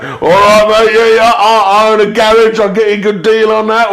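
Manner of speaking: hammed-up London bloke offering a deal; London geezer. Strangers in London greeting him, a loud Cockney geezer. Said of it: "geezers were coming up being like".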